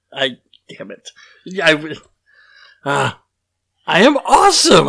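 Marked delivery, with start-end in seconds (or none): 3.9-4.9 much higher and louder, a big proud cheer; triumphant shout. Acted. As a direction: belt it out like a victory cry. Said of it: "try and repeat something back in the same proper tone".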